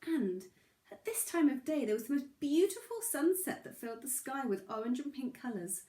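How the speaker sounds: gentle, unhurried read-aloud; storybook narration. A narrator's reading. Reading a bedtime story to little Charlie, gentle narration. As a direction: tell it like a bedtime story for a small child.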